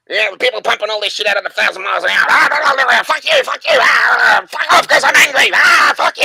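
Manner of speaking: high, fast screaming rant; hammed-up raving shout in a high put-on voice; mock ranting yell. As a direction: scream it like a crazed sample on a rave track. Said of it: "yelling and ranting and raving".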